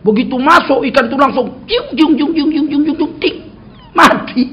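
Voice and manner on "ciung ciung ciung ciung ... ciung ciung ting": high, rapid repeated zipping noises; imitated darting noise. Preacher mimics fish thrashing in the bucket, imitated sound effect. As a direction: make quick high "ciung" noises, ending on a ting.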